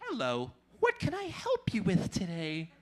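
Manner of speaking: lightly voiced calm Siri assistant; a hint of a smooth phone assistant; mild Siri impression. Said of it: "here's Siri".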